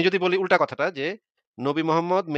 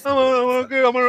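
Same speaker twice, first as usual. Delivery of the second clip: high whiny mock of an offended believer; 8 semitones higher, 4 dB louder than usual. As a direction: ham up his hurt feelings, pitched high. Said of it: "আপনি তার কথাটা mock করলেন কেন?".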